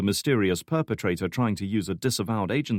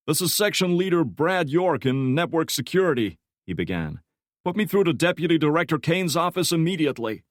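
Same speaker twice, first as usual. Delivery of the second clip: higher, louder official phone voice; York demanding; 8 semitones higher, 4 dB louder than usual. A narrator's reading. Section chief demanding the deputy director on the phone. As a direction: demand to be put through now.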